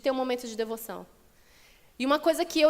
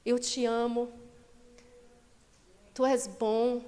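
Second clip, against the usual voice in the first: tender devotion; slower than usual. Shown as a demonstration. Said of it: "de falar assim".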